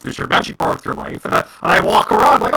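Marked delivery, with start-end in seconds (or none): none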